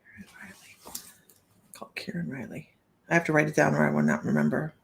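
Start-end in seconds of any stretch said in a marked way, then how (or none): 0.0-2.7 whispered name reminder; whisper to herself. Real, not acted. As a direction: breathe the name to yourself.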